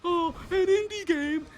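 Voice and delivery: high, wailing mock lament; mock whiny fan. Vlogger mocks fans excusing the game with a high wailing lament. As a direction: wail it like a melodramatic fanboy.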